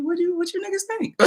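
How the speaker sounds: mock quote. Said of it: "I'm like".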